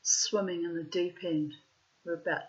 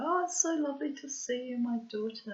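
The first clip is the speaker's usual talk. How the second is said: high, put-on delight; convivial act; 6 semitones higher than usual. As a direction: play up a sugary show of welcome. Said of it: "acting all very, you know, convivial".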